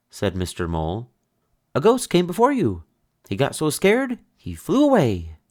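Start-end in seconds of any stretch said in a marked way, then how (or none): 1.7-5.4 excited telling. Acted. Mole excitedly telling the ghost story.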